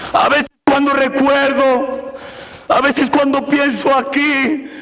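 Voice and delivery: urgent preacher, pitch up and pushing hard; impassioned preaching. Acted. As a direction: preach it like a lost soul is on you. Preacher builds to full force.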